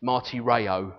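"martyreo" with untapped English r sounds; English accent. Shown as a demonstration. Preacher showing what English speakers get, English accent. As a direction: say "martyreo" as an English reader would. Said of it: "say it in an English accent".

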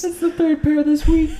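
high, slow laughing joke. Real, not acted. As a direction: crack up while saying it. S1 cracks up joking.

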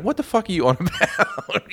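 high, laughing mock disbelief; laughing mock retort. Friends laughing off a cry for help, high and amused. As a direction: scoff at him through a laugh. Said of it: "you guys are coming out".

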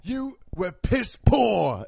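loud, slow British put-down; drawn-out mock chef's yell; Gordon yelling. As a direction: hammer out each word as an irate chef. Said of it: "British guy comes in and just yells".

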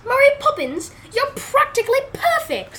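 high, bright British accent. Shown as a demonstration. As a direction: put on a British accent for the line. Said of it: "your British accent".

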